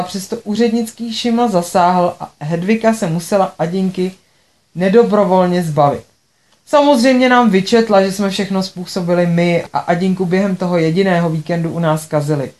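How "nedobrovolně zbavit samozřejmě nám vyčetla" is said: ordinary delivery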